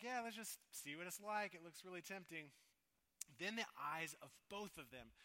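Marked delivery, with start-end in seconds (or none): 0.0-2.5 mock tempted voice. Voicing Adam and Eve tempted.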